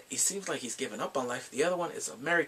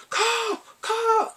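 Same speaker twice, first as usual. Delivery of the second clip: loud, high-pitched chant; mock urging; 6 dB louder than usual. Online friends pushing him to phone her, mock urgent yelling. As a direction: yelp each word high, egging him on.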